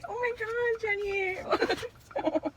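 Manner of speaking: ordinary talk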